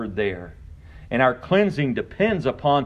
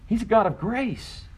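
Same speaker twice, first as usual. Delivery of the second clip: preaching at full force; 3 semitones higher than usual. Acted. Pastor to congregation on works and grace, preaching at full force. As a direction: preach it hard, pitched high over the congregation.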